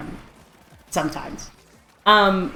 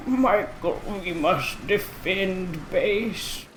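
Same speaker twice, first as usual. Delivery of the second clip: slow, hushed bad orc voice; brutish orc; 7 dB quieter, much slower than usual. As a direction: strain to sound like a brutish orc. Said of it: "a brutish orc".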